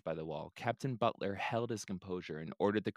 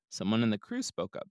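ordinary delivery, nothing marked

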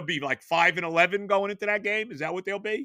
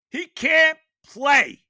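1 emphatic; 7 semitones higher, 8 dB louder, much slower than usual. Real, not acted. Fan ranting, repeats.

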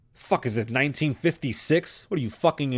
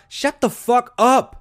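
high, loud, drawn-out yell; exasperated shouting, each word leaned on; angry shout; 8 semitones higher, 7 dB louder, slower than usual. Acted. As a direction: cut him off with a stretched-out holler. Cuts off the mocked speaker angrily.

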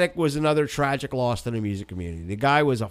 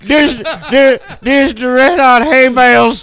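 loud laugh through the words; 8 semitones higher, 10 dB louder than usual. Real, not acted. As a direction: crack up while joking. Laughing mock band name.

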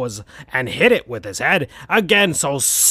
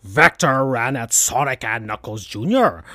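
plain reading voice

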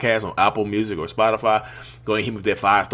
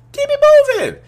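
emphatic exclamation; 8 dB louder, faster than usual. Real, not acted. Advising listeners on a narcissist ex, high exclaimed punchline. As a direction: Keep it moving squeaked way up high.

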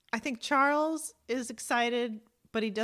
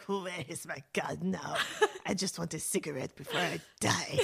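a resigned soldier at the end; weary old cat; 5 semitones lower than usual. Pretend. Voicing the old cat Lady, mildly world-weary. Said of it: "She's like".

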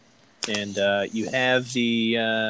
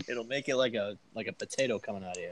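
normal talking voice

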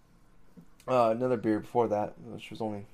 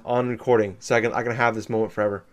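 normal talking voice

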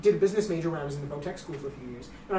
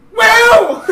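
sudden high yell; shout; 15 dB louder than usual. Retold or imitated. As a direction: startle him with a yell. Scaring the guard by yelling.

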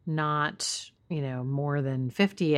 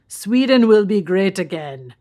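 Swedish person speaking English; Swedish accent; 4 semitones higher, 7 dB louder than usual. Retold or imitated. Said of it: "What accent was that".

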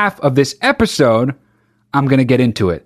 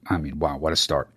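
muttered aside; 10 semitones lower, 11 dB quieter than usual. Real, not acted. Aside on the article's start.

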